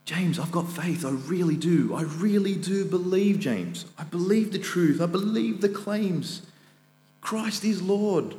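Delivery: high, pleading objector voice, slowing on Christ is Lord; imaginary objector. Retold or imitated. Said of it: "imagining an objector who's saying".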